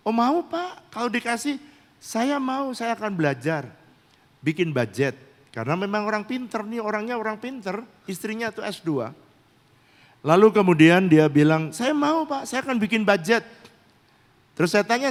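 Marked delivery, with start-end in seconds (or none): 0.1-5.2 high-pitched imitation of an eager woman; eager wife. Wife agreeing to her pastor's budget proposal, imitated eagerness. 11.7-13.4 much higher, imitated female voice; eager wife. Wife promising her pastor a budget, imitated eagerness.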